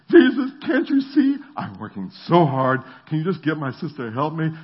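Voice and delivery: high, loud exasperated plea; frantic complaint. Retold or imitated. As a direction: whine at Jesus, overworked and fed up. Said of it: "she wigs out".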